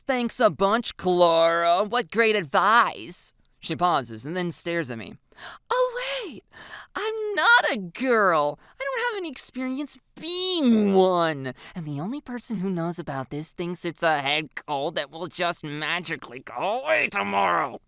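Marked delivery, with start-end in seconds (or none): 0.1-3.3 loud, high, drawn-out sarcasm; overdone mock gratitude, stretched at the end; sarcastic. A narrator's reading. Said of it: "dripping with sarcasm". 5.7-17.8 sarcastic rant. A narrator's reading.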